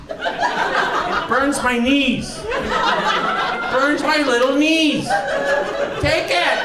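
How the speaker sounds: very high, drawn-out mock complaint; hammed-up high-pitched grievance; pleading comic whine. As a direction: wail it slowly in mock suffering. Comic complaint to imagined thief.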